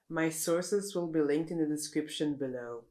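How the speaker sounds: usual speaking voice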